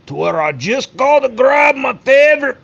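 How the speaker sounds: high, strained maniac voice; crazed killer voice. Retold or imitated. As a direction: go full unhinged killer. Acting out killer's thought.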